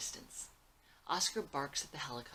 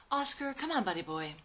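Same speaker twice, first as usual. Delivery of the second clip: high, loud call to the dog; 10 semitones higher, 8 dB louder than usual. Acted. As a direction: call out to a sleepy dog across the store. Calling the dog to come.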